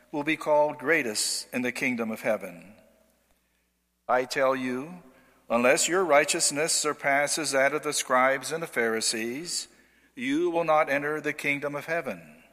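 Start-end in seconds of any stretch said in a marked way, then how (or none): none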